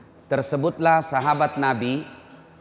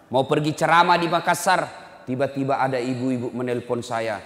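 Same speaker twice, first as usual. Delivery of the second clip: normal talking voice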